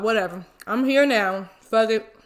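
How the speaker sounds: low, soft, resigned grumble under the breath. Retold or imitated. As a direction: give up and let it go, quietly. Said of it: "So I'm like".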